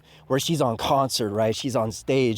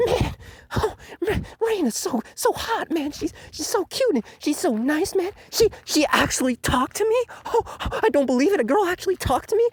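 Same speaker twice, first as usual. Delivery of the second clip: much higher, giddy lovesick voice; mock lovestruck nerd; 13 semitones higher than usual. Mocking Shinjiro gushing over the idol, high giddy voice. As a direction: swoon like a dorky teen over a girl. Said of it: "He's like".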